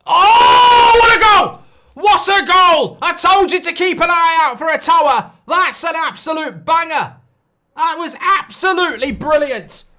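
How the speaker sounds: full-throated, high goal scream; long goal yell. Real, not acted. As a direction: roar it like a commentator at a goal. Live goal scored on stream.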